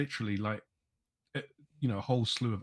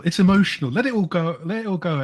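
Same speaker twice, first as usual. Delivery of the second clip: higher and louder, letting it all out; excited shouting; 7 semitones higher, 9 dB louder than usual. Real, not acted. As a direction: cry it out in joy, full voice. Fan overwhelmed by transfer news.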